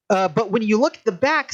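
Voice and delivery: ordinary talk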